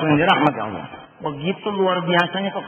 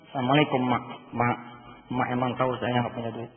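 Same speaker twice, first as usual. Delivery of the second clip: soft, low, halting greeting to mother; subdued child talking to mother; hesitant confession; 7 semitones lower, 8 dB quieter than usual. Acted. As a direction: confess it softly, pausing after each Mak. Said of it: "Atau nggak usah dikasih tahu lah".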